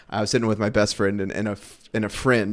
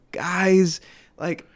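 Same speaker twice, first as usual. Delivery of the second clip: much higher, slow, pleading preacher voice; imitating an earnest preacher pleading with the crowd, high and slow; earnest plea; 13 semitones higher, 3 dB louder, much slower than usual. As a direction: beg the crowd to get it, stretched out and high. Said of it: "just really quickly and really earnestly going".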